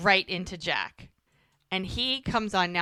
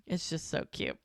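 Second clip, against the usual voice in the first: ordinary delivery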